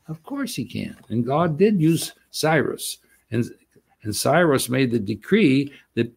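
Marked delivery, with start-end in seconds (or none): 0.1-1.0 of course he can, said much softer, dropping back; softer echo. Shown as a demonstration. Preacher echoing his point to the congregation, hushed. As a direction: repeat it under your breath. Preacher repeats line two ways.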